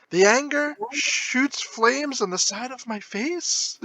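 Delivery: high, drawn-out movie-quote impression. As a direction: imitate her anger, stretched out and high. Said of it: "she improv the scene where she was like".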